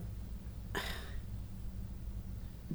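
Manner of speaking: long, soft exhaled sigh. Acted. Pepper hesitating before she answers the Captain, long soft sigh. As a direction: let out a slow, quiet sigh.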